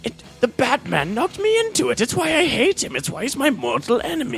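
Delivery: high, strange Joker voice. Acted. Joker telling Penguin his origin, in a high voice. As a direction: play a high-pitched Joker. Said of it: "That sounds like Heath Ledger's Joker".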